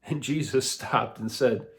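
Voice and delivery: usual speaking voice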